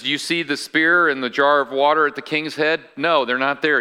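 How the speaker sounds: usual speaking voice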